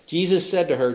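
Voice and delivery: ordinary talk, nothing marked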